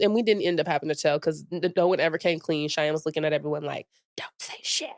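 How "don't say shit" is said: hushed, voiceless imitation of a threat; whispered warning. Friend warns classmates not to snitch, whispering. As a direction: whisper it as a secret order.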